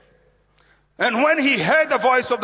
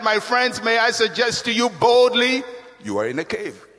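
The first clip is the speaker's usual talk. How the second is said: normal talking voice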